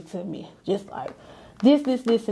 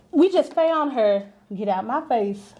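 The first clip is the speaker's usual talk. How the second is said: usual speaking voice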